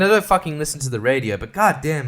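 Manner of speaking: ordinary talk, nothing marked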